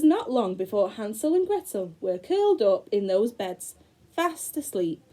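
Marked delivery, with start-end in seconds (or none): none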